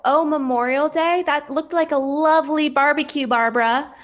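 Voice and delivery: high, loud mock realization; imitated sarcastic comeback. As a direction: throw it back with exaggerated surprise. Quotes relative's sarcastic comeback.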